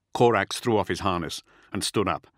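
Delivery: plain reading voice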